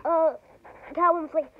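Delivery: normal talking voice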